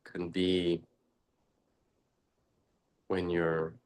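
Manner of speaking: ordinary talk, nothing marked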